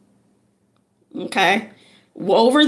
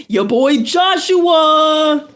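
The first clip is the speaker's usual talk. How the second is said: laughing, louder New York voice; New York accent with a laugh; 7 semitones higher, 5 dB louder, slower than usual. Pretend. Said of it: "New York accent".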